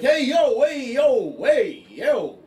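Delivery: loud, high "hey, yo" shouted across the gym; calling out. Acted. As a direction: hail him loudly from across the room. Gym guy calling out at player.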